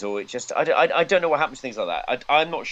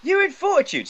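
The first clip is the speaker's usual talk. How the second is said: much higher, squeaky mock voice. Shown as a demonstration. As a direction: say it way up high. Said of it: "I'll say that in a lower voice".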